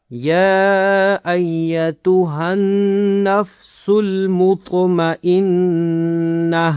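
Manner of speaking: drawn-out يا ايتها with a heavy ط in المطمئنہ; syllable by syllable, very slowly; reciting slowly to show the heavy ط; very slow tajweed. Shown as a demonstration. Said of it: "تو کو موٹا کرنا ہے".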